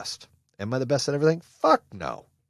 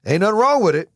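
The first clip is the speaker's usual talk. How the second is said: emphatic; 9 semitones higher, 8 dB louder, faster than usual. Real, not acted. Repeated line, first louder.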